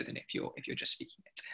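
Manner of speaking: whisper. Real, not acted. Whispered aside.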